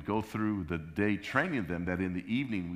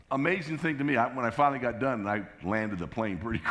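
normal talking voice

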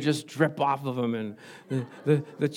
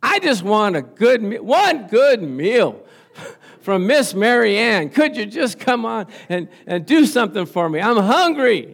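loud, high mock-desperate prayer; comic cry from the depths of the soul; crying out to God; 5 semitones higher, 7 dB louder than usual. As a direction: plead loudly to heaven, hammed-up. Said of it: "I cried out".

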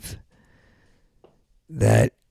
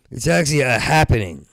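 ordinary delivery

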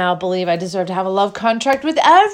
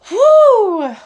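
loud, high whoop; exhilarated whoo; 12 semitones higher, 11 dB louder than usual. Real, not acted. Speaker overwhelmed by joy before sipping tea, exhilarated whoop. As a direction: let out a big high "whoo".

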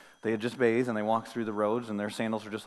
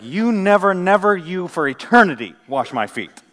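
loud, raised-pitch acted refusal; emphatic; 6 semitones higher, 13 dB louder than usual. Disciple refusing the foot washing for all eternity, emphatic. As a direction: protest with full force, hammering each denial. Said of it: "literally it's emphatic".

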